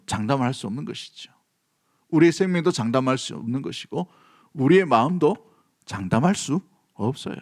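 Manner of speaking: usual speaking voice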